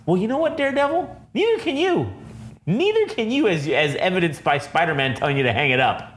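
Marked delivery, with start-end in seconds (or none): 0.1-3.5 high, taunting mock voice; mocking high retort. Mocking Daredevil's own line back at him in a high taunt. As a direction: throw his words back at him, high. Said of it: "You can't outrun your own violence".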